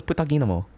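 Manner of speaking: puta ginamo rattled off fast and smooth; fluent Filipino curse. Shown as a demonstration. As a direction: fire off puta ginamo like a native. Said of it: "I'm being a little too good at that one".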